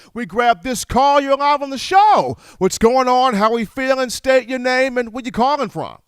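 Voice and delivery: upbeat, punchy call-in host intro; radio host hype. Acted. Radio host greeting a new caller, upbeat and punchy. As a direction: pump up the next caller as you bring them on air.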